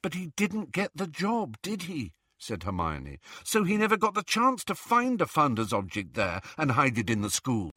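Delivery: earnest, logical rebuttal; reasoning Hermione. Acted. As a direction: lead them gently to the conclusion. Voices Hermione in the story.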